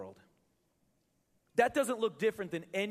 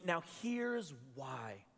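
slow dramatic reveal; 4 semitones lower, 5 dB quieter, much slower than usual. Retold or imitated. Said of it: "And then he goes".

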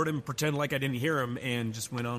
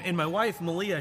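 normal talking voice

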